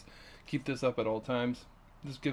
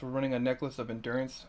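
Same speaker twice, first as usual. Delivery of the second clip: ordinary delivery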